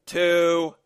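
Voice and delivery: loud, high, held-out number; countdown. Acted. Gunman about to fire, loud menacing countdown. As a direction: call it out as a warning. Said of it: "the leader counted down".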